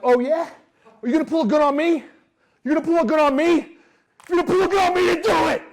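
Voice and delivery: high, loud, fast taunting shout; defiant yelling. Retold or imitated. Salesman daring the gunman to fire, yelling. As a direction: dare him at full voice to shoot. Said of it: "That's exactly how I said it".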